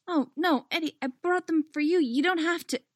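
high-pitched, flustered protest; light high voice, hurrying to object; flustered girl. A narrator's reading. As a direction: pitch it up, anxious to reassure.

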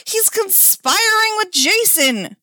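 high, loud, drawn-out denouncement; melodramatic accusation. Acted. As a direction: expose the plot at full volume. Hammy accusation in the game.

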